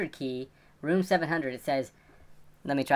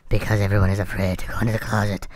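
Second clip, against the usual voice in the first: low, slow creepy voice; 10 semitones lower, 3 dB louder than usual. Shown as a demonstration. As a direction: drag it out low and eerie. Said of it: "she have this creepy voice like this".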